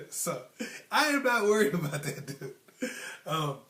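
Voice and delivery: talking through a real chuckle; amused, real laughter in the words; laughing. Real, not acted. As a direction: laugh it off while speaking. Laughs at his own joke.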